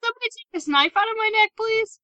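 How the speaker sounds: high, fast, desperate plea; high pleading voice. Acted. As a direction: beg for help in a squeaky voice. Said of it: "He's just like".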